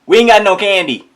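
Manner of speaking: high, loud scolding mother; loud high mock mom. Mom telling kids off, high loud scold. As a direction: belt it like an annoyed mom. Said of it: "was like".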